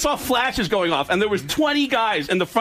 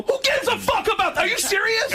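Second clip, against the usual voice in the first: much higher, outraged disbelief; incredulous yell. Retold or imitated. To his buddy about the new car, a high incredulous yell. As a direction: yelp it up high, can't believe it.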